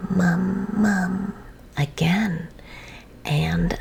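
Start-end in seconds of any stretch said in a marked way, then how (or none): 0.1-1.3 put-on baby voice. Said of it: "she said".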